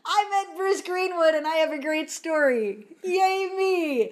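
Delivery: high-pitched, sing-out retold triumph; gleeful boasting. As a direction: celebrate your own little victory in a high voice. Retells her glee after meeting star.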